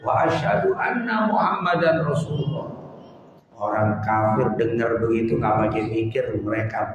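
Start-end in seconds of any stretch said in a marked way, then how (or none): 0.1-2.7 retold shout. Preacher retells a convert proclaiming faith atop a rock, shouting. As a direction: call out the shahada in a raised, ringing pitch. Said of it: "dia teriak".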